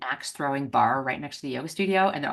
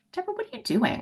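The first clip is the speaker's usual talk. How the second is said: pitched way up, curious; high curious question; faster than usual. Retold or imitated. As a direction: ask it high and eager. Students asking, excited.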